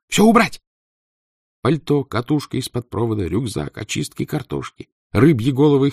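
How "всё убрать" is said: loud urgent command; commanding shout. Acted. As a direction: bark the orders. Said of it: "скомандовала Татьяна".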